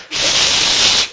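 imitated spray paint, long shushing hiss; spray-can hiss. Preacher pretends to spray yellow paint on the sign, spray-can hiss.

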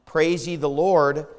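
normal talking voice

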